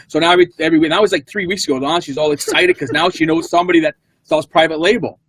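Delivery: usual speaking voice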